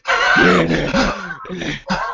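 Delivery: airless, wheezing gasp; mock wheeze. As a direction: fake the wheeze of a winded runner. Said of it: "It was like".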